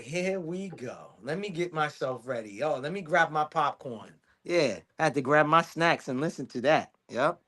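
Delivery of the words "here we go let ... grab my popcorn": weary resignation. Retold or imitated. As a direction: settle in like a long show is coming. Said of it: "I'm like".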